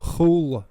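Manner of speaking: "chula" with a throaty hiss in place of the C; lenited C. Shown as a demonstration. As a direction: breathe out the start of "chula". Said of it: "it would end up sounding something like".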